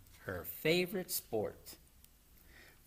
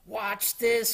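higher, showy call; eager cry; 8 semitones higher, 4 dB louder than usual. A narrator's reading. Froggy's turn to bowl, eager raised cry. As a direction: cry out for attention. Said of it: "cried Froggy".

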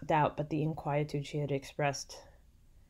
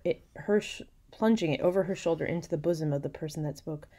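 usual speaking voice

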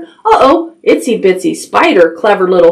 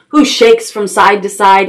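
usual speaking voice

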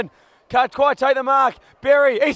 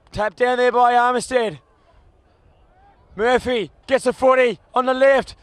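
ordinary talk

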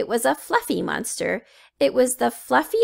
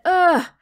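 high loud ugh of disgust; loud grossed-out ugh; disgusted groan; 8 semitones higher, 6 dB louder than usual. A narrator's reading. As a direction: gag at the smell. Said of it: "Then he said".

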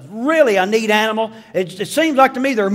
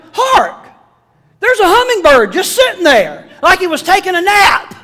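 much higher, louder mock-grand cry; excited exclamation; 11 semitones higher, 6 dB louder than usual. He finds a hummingbird napping, exclaiming high and loud. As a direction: announce it like a herald.

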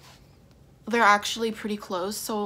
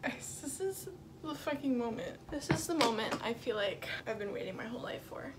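soft, near-whispered reverence; hushed awe; 10 dB quieter than usual. Real, not acted. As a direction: hush it for a long-awaited moment. Awed before long-awaited guitar.

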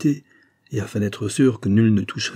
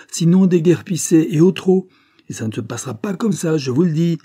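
higher, building to loud outbursts; indignant protest; 6 semitones higher, 4 dB louder than usual. A narrator's reading. Former lawyer challenging police in the night, indignant. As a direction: angrily demand that they clear out.

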